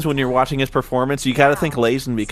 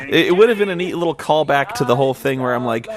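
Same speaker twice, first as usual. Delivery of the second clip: ordinary talk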